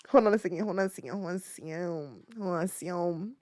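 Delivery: very soft, low repeated murmur; distracted mutter. Real, not acted. Streamer busy looking up photos, distracted mutter. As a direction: mumble it to yourself, fading away.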